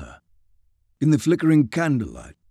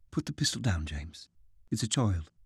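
quiet urging; 5 semitones lower, 10 dB quieter than usual. A narrator's reading. Caitlin urges James softly.

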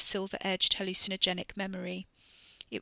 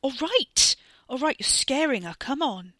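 loud and higher, backing down as it goes; urgent shout; 6 semitones higher, 8 dB louder than usual. A narrator's reading. Wes yelling at Ricky to stop scaring Katie, urgent shout. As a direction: shout him down, then ease off.

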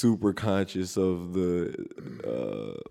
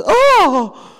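sudden very high, loud mock cry of pleasure; mock moan cry; 11 dB louder than usual. Brother imitates mom's yelp during spades, very high and loud. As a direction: blurt a high yelp like mom did.

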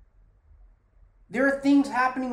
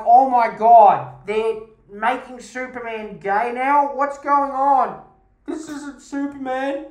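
slow, drawn-out, much louder gasp of mock outrage; mock outraged fan; 3 semitones higher, 7 dB louder, slower than usual. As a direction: ham up a scandalised comic fan. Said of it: "for you to be like".

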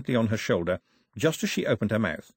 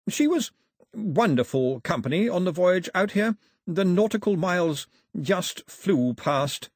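high, effusive courtly praise; 8 semitones higher than usual. Acted. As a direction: praise her in a raised, formal voice.

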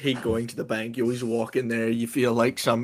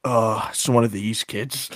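dismissive; 7 dB louder than usual. Retold or imitated. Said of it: "they just clearly give me".

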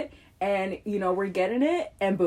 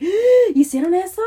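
scolding relative; 11 semitones higher, 11 dB louder than usual. Retold or imitated. Mocking scolding family member.

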